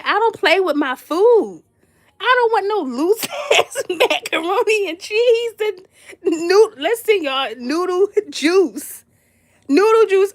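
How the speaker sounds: high, loud, laughing rant; mock outraged rant. Guest ranting at host about watery macaroni, loud disgust.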